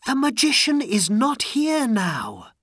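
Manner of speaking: high, loud princess call. A narrator's reading. Princess tells Aladdin the way is clear, calling out. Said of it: "the princess called".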